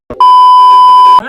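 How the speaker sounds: huge high slow shout to the crowd; stretched, roaring punchline shout; big shout. Real, not acted. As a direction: belt it out, stretching every word. Peak of her funny story.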